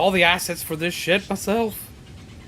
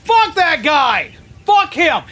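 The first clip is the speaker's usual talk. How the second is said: higher, louder, slow shouted curse; angry shout; 6 semitones higher, 4 dB louder, slower than usual. Real, not acted. Streamer shouting curses at the commander, furious. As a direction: bellow it out, furious. Raging at the game character.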